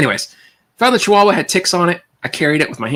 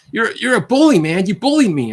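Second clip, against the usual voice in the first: much higher, whining accusation; whiny bully voice; 10 semitones higher than usual. Retold or imitated. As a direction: whine like the victim. Bully whining after punch.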